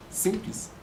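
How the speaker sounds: usual speaking voice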